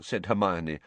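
usual speaking voice

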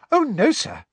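very high, cheerful squeaky elf; cheerful squeaky house elf; happily. A narrator's reading. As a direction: chirp it happily like a little elf. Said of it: "said Dobby happily".

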